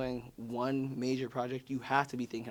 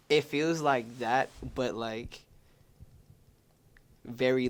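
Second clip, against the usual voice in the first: ordinary talk, nothing marked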